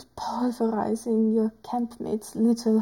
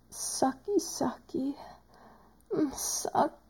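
softer coo fading into a whisper; 2 semitones higher, 6 dB quieter than usual. Acted. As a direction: coax softly, letting it die away.

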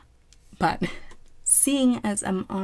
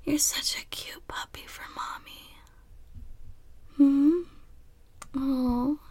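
whispering; 9 semitones higher, slower than usual. Acted. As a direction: whisper it close and playful.

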